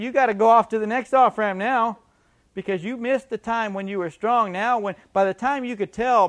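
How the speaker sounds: loud, high, forceful preaching. Acted. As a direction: drive it home loudly from the pulpit.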